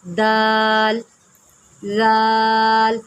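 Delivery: دال and زال stretched very slowly; دال، زال with long held aa; long drawn letters. Shown as a demonstration. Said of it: "ہم نے اس کو زال پڑھنا ہے".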